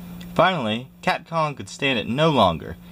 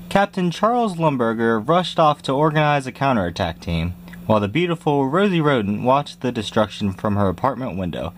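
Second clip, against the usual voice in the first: plain reading voice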